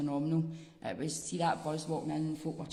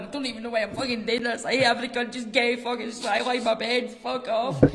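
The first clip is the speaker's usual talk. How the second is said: mock crying; 6 semitones higher, 7 dB louder than usual. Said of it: "greeting, going".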